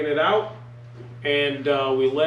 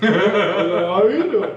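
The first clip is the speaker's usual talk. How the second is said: drawn-out, loud, high friendly hello; overly slow greeting; 5 semitones higher, 6 dB louder, much slower than usual. Retold or imitated. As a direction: stretch every word like a stoned fake-calm guy. Tripping on acid, greeting the cop.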